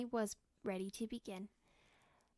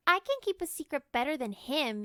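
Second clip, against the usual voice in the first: loud, higher competitive yell; 4 semitones higher, 8 dB louder than usual. Acted. Girl boasting to her siblings, loud yell. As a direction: shout it to beat your brother. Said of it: "yelled Kelly".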